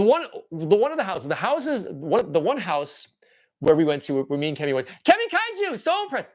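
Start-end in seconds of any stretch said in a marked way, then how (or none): 5.1-6.3 excited. Real, not acted. High excited greeting.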